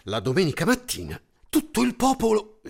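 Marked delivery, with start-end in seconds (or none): none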